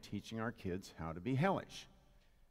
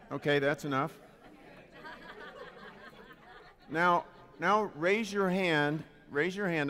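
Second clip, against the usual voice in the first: raised voice over a chattering crowd; loud, commanding announcement to the audience; calling for attention; 8 semitones higher, 6 dB louder than usual. Real, not acted. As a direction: call the room back to order.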